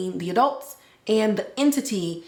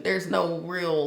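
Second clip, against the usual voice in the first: usual speaking voice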